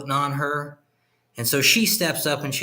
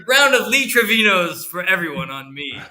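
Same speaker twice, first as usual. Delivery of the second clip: booming generous round; 7 semitones higher, 6 dB louder than usual. Retold or imitated. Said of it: "and he goes".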